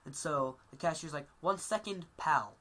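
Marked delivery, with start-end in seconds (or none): 1.4-2.6 snide brush-off, louder and punching pal; snide cashier. Retold or imitated. Burger King cashier brushing off Dane Cook, snide. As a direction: make pal a sneer. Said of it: "He says it exactly like that".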